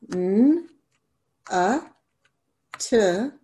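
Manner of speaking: n, a, t as separate letter sounds; sounding out letters. Shown as a demonstration. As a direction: sound out n, a, t slowly for a child. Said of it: "sound out each syllable".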